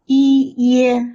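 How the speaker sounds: "Yi, ye" high, loud and held level; drawn-out syllable demo. Shown as a demonstration. Teacher reviews the final ye, high level syllables. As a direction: sound out "Yi, ye" one at a time, high. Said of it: "We have learned the final ye".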